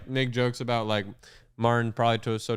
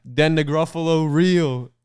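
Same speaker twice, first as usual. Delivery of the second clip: dramatic reveal; 5 semitones higher, 6 dB louder, slower than usual. Retold or imitated. Dramatic story twist.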